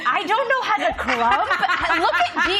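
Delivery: laughing through the words; giggly and incredulous. Real, not acted. Host laughing at crumping idea.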